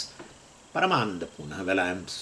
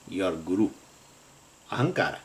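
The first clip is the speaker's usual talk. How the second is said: ordinary delivery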